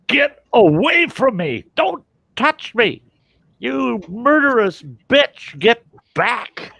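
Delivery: high, slow-drawn yell of someone losing it; furious yell. Retold or imitated. Patient yelling at the doctor working on his finger, furious yell. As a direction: scream it at the doctor to back off. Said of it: "I yelled at her".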